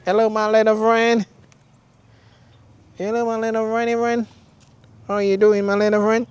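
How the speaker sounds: high sing-song greeting to an animal; pet talk. Real, not acted. Talking to a little animal friend.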